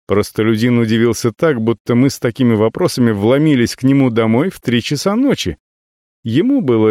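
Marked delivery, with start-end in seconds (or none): none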